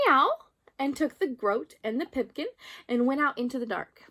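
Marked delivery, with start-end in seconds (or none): 0.1-0.4 high, loud cat meow; cat's meow. A narrator's reading. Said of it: "Simpkin again said".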